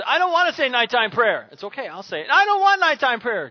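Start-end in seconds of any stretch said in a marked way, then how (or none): 0.0-1.5 put-on high, loud little boy's whine; whiny child refusing. Little son refusing his nighttime prayer, high whine. 2.3-3.5 put-on high, loud little boy's whine; whiny child refusing. Little son refusing his nighttime prayer, high whine.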